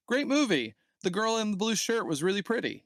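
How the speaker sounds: imitated child reviewer. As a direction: recite it like a child's earnest little review. Reads out son's naive reviews.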